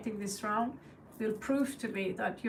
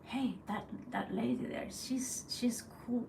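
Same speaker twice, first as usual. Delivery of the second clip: crows gossiping; 7 dB quieter than usual. Retold or imitated. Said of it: "and they will be like".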